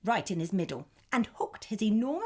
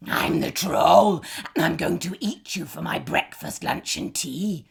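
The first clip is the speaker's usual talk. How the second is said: greedy troll; 9 dB louder than usual. A narrator's reading. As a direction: drag out the threat. Troll speaks.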